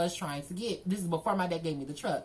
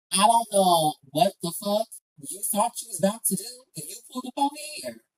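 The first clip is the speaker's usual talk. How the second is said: usual speaking voice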